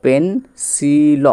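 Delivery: পেন্সিল drawn out slowly as pen-sil; slow sounded-out word. Shown as a demonstration. Teacher slowly sounding out পেন্সিল for pupils. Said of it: "তাহলে উচ্চারণটা আসলো".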